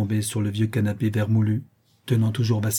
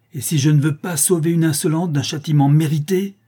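raised, loud, angry challenge; enraged; 5 semitones higher, 5 dB louder than usual. A narrator's reading. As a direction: fling back a furious question. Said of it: "blême de rage". (riposte pleine de rage)

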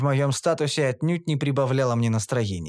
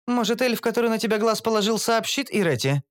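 higher, brisk female voice for Kara; Кара, женский голос; 8 semitones higher than usual. A narrator's reading. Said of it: "предположила Кара". (Кара, высокий бойкий голос)

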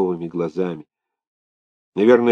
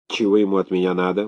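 plain reading voice

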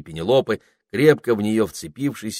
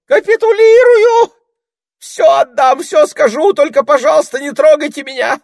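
panicked pleading; 14 dB louder than usual. A narrator's reading. Terrified ermine surrendering.

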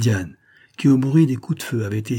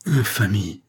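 naive outrage; slower than usual. A narrator's reading. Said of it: "avec un accent si naïf".

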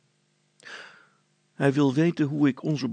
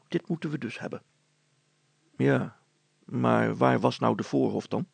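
ordinary delivery

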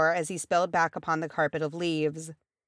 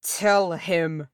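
sudden growled command; 3 dB louder than usual. A narrator's reading. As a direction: growl it as an order. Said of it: "Thorin growled suddenly".

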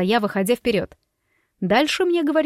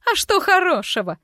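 shrieking retort; 8 semitones higher than usual. A narrator's reading. Madam Norgentaundon shrieks back at the crowd, shrill and high. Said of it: "взвизгнула госпожа Норгентаундон".

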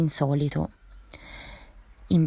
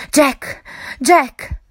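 higher, louder frightened calling; panicked shout of a friend's name; 8 semitones higher, 5 dB louder than usual. Acted. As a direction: cry out for help in terror. Terrified call to his companion.

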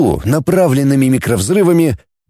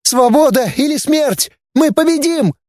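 very high fanatic's slogans; high-pitched girl agitator, climbing higher; hysterical ranting; 13 semitones higher than usual. A narrator's reading. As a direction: cry slogans in a squealing voice. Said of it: "кликушествовала она".

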